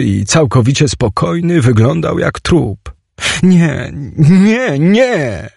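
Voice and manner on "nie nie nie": high cries of disbelief; horrified denial. Acted. Teoś sees Chimek looking like a corpse, high horrified cries. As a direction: cry out against what you see. Horror at seemingly dead friend.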